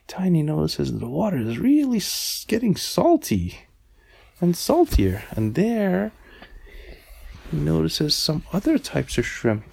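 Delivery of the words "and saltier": usual speaking voice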